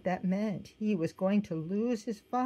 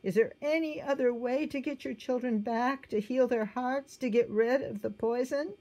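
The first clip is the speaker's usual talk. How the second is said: anguished plea; 7 semitones higher than usual. A narrator's reading. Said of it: "Jesus cried".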